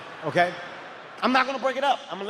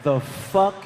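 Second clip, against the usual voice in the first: son cursing, mock-stunned; 3 semitones lower, much slower than usual. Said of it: "This is what my son said".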